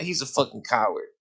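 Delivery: ordinary talk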